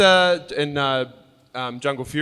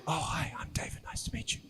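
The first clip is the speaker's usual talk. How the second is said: breathy whisper, much softer; whispered hello; 3 semitones higher, 13 dB quieter, faster than usual. Retold or imitated. David retelling greeting Claire quietly, whispering. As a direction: whisper it like meeting someone mid-panel. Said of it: "And I said".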